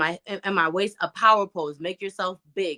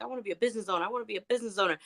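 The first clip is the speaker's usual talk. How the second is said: softer, lightly voiced childhood self; quoting yourself as an eager kid, lightly; 2 semitones higher, 7 dB quieter, much faster than usual. Woman recalling what she'd say as a kid, lightly voiced child self. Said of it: "I talked about as a kid".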